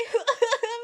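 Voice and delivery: high, loud maniacal cackle; crazed laugh. Acted. Possessed teacher breaks into a high, loud maniacal cackle. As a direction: let out an unhinged laugh.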